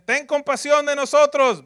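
loud high plea for mercy; pleading cry. Acted. Lepers begging Jesus, loud high pleading. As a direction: cry out as if from far away. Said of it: "ellos dicen".

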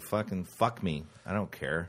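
resigned shrug. Retold or imitated. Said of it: "the attitude of".

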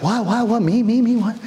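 a hint of a startled Mexican man; lightly imitated Mexican accent. Startled father singled out by the team leader, lightly imitated Mexican accent. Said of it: "it was a Mexican guy".

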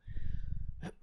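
clearing a rough throat; hoarse throat clear. Real, not acted. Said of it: "I'm losing my voice".

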